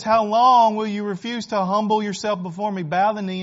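forceful preacher's call; loud pleading challenge. Acted. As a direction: demand an answer from the crowd. Preacher presses the congregation.

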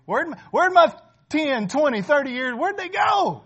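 high, loud exclamation of disbelief at lost years; mock anguished cry. Pastor voicing an aging churchgoer, a loud mock lament. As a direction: cry out like someone who just noticed half a lifetime gone.

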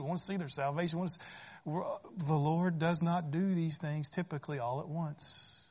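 usual speaking voice

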